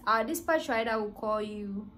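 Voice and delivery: normal talking voice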